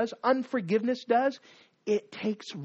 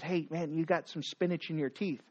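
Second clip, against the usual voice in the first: softer friendly tip; 4 semitones lower, 7 dB quieter than usual. Acted. Friend pointing out spinach.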